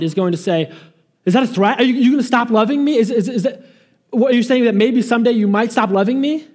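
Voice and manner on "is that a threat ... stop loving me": higher, fast, incredulous mock wife; hammed-up alarmed wife asking if it's a threat; mock wife's alarm. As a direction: play the baffled wife, half laughing. Mock wife questioning husband.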